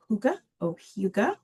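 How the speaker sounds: "hygge" slowly as HOO-guh, then HYOO-guh; two pronunciations. Shown as a demonstration. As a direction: give "hygge" in two careful variants. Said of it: "So the pronunciation is".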